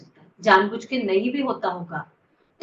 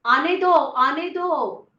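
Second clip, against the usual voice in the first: slow, higher, stretched-out call; drawn-out urging; 4 semitones higher, much slower than usual. Real, not acted. Teacher telling the class to let it come, drawn-out urging. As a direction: coax it out with long vowels.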